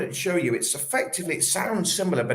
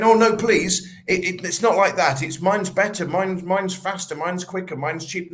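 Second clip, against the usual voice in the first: louder, higher pleading seller, begging to be chosen; desperate pleading; 5 semitones higher, 4 dB louder than usual. Retold or imitated. Salesman begging a reluctant client, loud and high desperate pleading. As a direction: beg the client to pick yours, voice up and loud. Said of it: "some sort of level of desperation".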